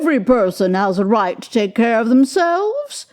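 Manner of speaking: a brazen woman shrugging it off; defiant old woman. A narrator's reading. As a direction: bold defiant charwoman. The woman speaks.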